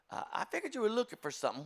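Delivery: high, quick eager shopkeeper; eager clerk. Retold or imitated. Clerk pressing the customer, high and eager. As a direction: rush it out like a too-happy salesman. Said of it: "suddenly he was so happy".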